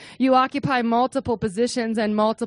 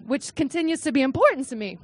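normal talking voice

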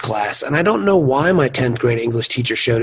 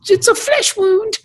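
much higher, slow, comic brush-off; Monty Python knight; slower than usual. Retold or imitated. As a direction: squeak it out as if nothing hurts. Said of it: "those scenes in Monty Python's".

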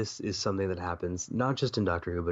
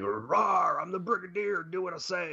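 high-pitched comic bluster; hammed-up commanding officer losing it; mock roaring Brigadier; 9 semitones higher than usual. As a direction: order them about in a high, roaring put-on voice. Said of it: "he just does this".

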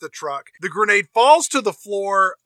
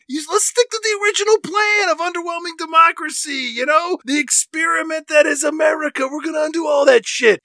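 excited henchman shouting; 8 semitones higher than usual. Pretend. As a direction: yell the scheme at the boss, higher. Henchman pleading with his boss.

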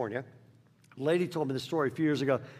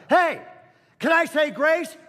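put-on high, loud kid calling out across the table; a little boy piping up loudly; loud child blurt; 9 semitones higher, 6 dB louder than usual. Excited boy shouting to the whole table.

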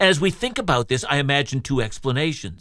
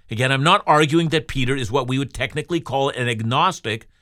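ordinary talk, nothing marked

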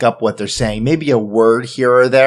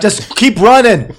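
urgent shout; 8 semitones higher, 5 dB louder, slower than usual. Acted. Urging everyone on while fleeing.

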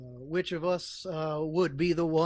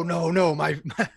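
normal talking voice

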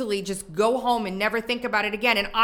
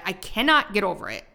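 usual speaking voice

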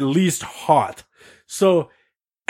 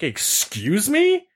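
slow, high-pitched disbelief; offended and incredulous; indignant; 7 semitones higher, much slower than usual. Retold or imitated. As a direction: draw it out as an affronted question. Indignant reply to the kid.

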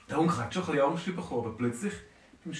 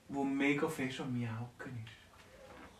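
ordinary delivery

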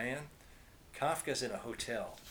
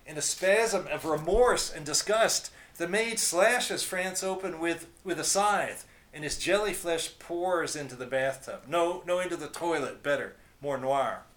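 excited; 4 semitones higher, 6 dB louder than usual. A narrator's reading. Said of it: "getting excited again".

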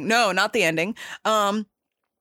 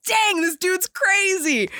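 mock-amazed, pitched up and slowed; a stunned viewer blurting it out, higher and slower; amazed reaction; 9 semitones higher than usual.

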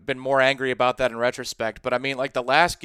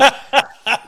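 burst of laughter; 8 semitones higher, 5 dB louder than usual. Real, not acted. Laughing at his own story.

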